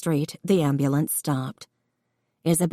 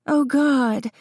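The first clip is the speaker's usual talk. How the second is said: dismayed gasp; 9 semitones higher than usual. Acted. Woman dismayed at labor.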